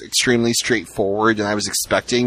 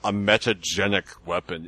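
a light mock Russian accent on "a metagenic weapon"; imitating Picard, Russian-ish; 5 semitones lower, 4 dB quieter than usual. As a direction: Picard quote with a faint Russian accent, hammed-up. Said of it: "And he said it just like that".

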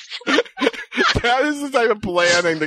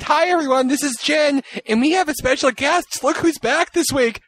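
mock podcast host; 9 semitones higher than usual. Imagined next episode intro.

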